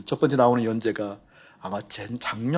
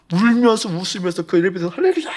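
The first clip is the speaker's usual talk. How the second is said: laughing, much higher Hallelujah shout; joyful shout; 8 semitones higher, 7 dB louder than usual. Retold or imitated. Pastor recalls shouting in the elevator, laughing for joy.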